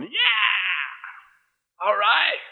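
high-pitched, elated cheer; acted stoked cheer. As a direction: whoop like you've just been let off. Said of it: "would you be stoked".

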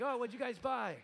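high, bright mock-friendly question; chirpy pastor greeting a startled congregant; cheery pastor.

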